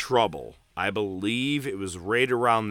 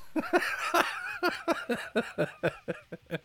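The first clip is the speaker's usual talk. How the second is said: high laugh; 7 dB quieter than usual. Real, not acted. After the shout.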